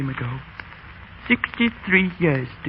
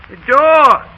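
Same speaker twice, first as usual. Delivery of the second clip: raised, higher call across the house; 8 semitones higher, 8 dB louder than usual. Acted. Old schoolmaster calling his landlady to answer the door, raised call. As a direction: shout for someone to get the door.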